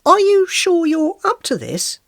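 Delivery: high, slow, sceptical question; doubtful inquiry. A narrator's reading. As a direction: ask it with pointed doubt. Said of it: "I inquired".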